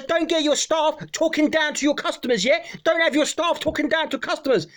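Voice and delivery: high-pitched mock telling-off; indignant customer. Customer scolding owner about his staff, high mock indignation. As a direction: act out a customer's heated complaint.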